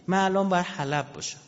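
ordinary talk, nothing marked

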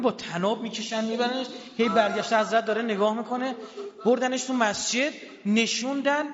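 high, loud grieving cry; rawzeh lament at full force. Acted. Preacher wailing Ali's dragging to the mosque, grieving cry. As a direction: wail it out to break hearts.

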